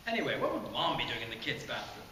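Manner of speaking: usual speaking voice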